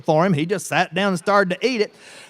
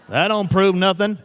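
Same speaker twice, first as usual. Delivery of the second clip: usual speaking voice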